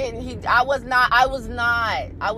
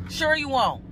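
ordinary talk, nothing marked

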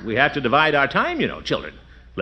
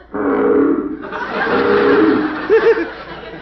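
a loud, lower croaking chuckle to outdo the frog; low bullfrog laugh; 11 semitones lower, 6 dB louder than usual. Acted. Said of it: "I bet I can go lower than he can".

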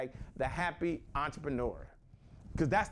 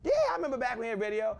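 eager reply; 6 semitones higher, 4 dB louder, much faster than usual. Retold or imitated. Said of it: "I said".

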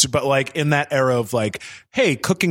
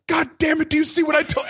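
high mock outburst of an angry dad; hammed-up angry father; imitating angry dad; faster than usual. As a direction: bark it like an exasperated father. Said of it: "And he was so angry".

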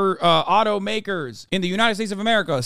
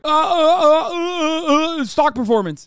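stammering CEO; 9 semitones higher, 3 dB louder than usual. Retold or imitated. Mocking CEOs crumbling under questions.

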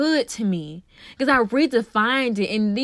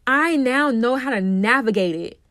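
usual speaking voice

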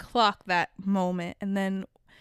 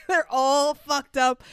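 laughing; 5 semitones higher, 4 dB louder, slower than usual. Real, not acted. Laughing over the fight scene.

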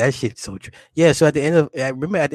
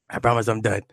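ordinary delivery

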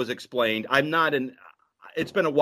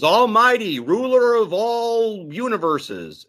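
alien announcing; 7 semitones higher, slower than usual. Retold or imitated. Retelling the cartoon alien hailing his king, grand and slow. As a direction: announce it like a pompous herald. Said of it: "And he goes".

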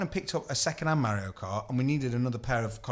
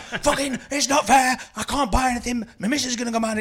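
mock angry caller; 10 semitones higher, 4 dB louder than usual. Angry caller voiced on phone.

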